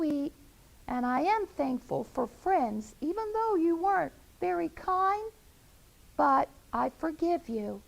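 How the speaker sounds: high, gracious mother hen; Henrietta forgiving. Acted. Hen inviting her unkind friends to Thanksgiving dinner. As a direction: announce forgiveness warmly and grandly. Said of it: "she said".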